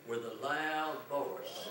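slow and deliberate, leaning on "loud"; slow, stressed "loud" for the congregation to repeat; emphatic phrase. Shown as a demonstration. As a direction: stretch out "With a loud voice" with weight on loud. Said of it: "Say this with me".

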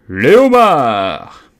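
long, drawn-out loud yell; shouted cry. A narrator's reading. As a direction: holler it across the waves. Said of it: "cria le griffon".

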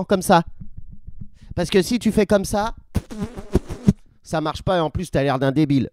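normal talking voice

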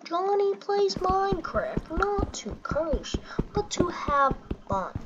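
slow, put-on parent lecture; mock sanctimonious parent. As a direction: spell it out slowly like a scolding parent. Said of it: "you're like".

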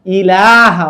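ইলাহা said firmly, long লা and short ই; correct reading. Shown as a demonstration. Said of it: "কি বলতে হবে শব্দটা".

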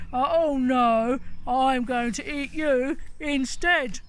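loud troll shout; troll hollering threat at the big goat, loud. Acted. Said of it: "the troll hollered".

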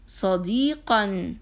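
صديقا joined, slow and drawn; slow blended word. Shown as a demonstration. Teacher to class, slowly blending صديقا. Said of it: "هيا اقرأوها معي مرة أخرى".

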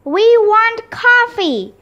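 high, insistent protest yell; repeated demand. A narrator's reading. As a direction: repeat it as a high picket-line cry. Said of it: "repeated Mister Klutz".

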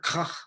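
Koch with a throaty German ch ending; guttural Koch. Shown as a demonstration. Author offering ways to say a subject's name, Koch with guttural ending. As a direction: say Koch as "kukh", scraping the final consonant. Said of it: "sometimes I pronounce it".

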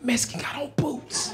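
hushed tip-off; urgent voiceless whisper; whispered warning. Retold or imitated. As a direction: break the news in a secret whisper. Passing on the secret warning.

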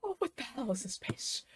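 ordinary talk

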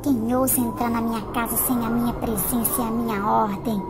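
a low, suspicious queen sniffing out intruders; low, ominous evil queen; menacing witch queen. Acted.

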